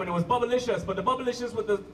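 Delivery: ordinary talk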